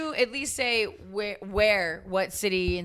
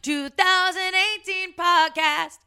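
slow, loud and high proclamation; triumphant announcing; 8 semitones higher, 5 dB louder, slower than usual. Acted. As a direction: proclaim it like a victory speech.

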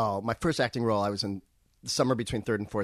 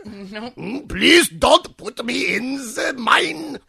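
high, slow, loud bird voice; canary voice; 11 semitones higher, 6 dB louder, much slower than usual. Pretend. Friend showing how a German canary should sound, high and slow. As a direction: whine like a canary in the mine. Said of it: "That's much better".

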